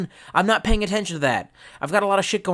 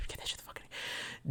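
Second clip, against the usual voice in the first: laughing whisper; 21 dB quieter, much faster than usual. Real, not acted.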